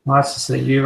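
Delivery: ordinary delivery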